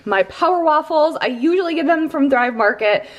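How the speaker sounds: ordinary delivery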